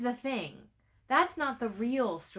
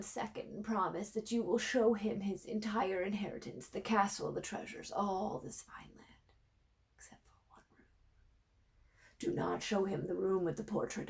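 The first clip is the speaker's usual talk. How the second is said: fading dying king, softer and whispered; weak dying voice; 5 dB quieter than usual. Acted. Old king on deathbed pleading with his servant, weak whisper. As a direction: force each word out with last breath. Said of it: "The king's voice was weak".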